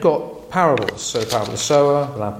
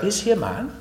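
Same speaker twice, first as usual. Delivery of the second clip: a hint of a wondering girl, higher; lightly voiced Lucy; 8 semitones higher than usual. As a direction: ask it like a curious child. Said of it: "she says".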